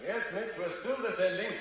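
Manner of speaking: high, faint shout from below; distant call. Acted. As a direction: call back up from far away. Answering from down the stairs.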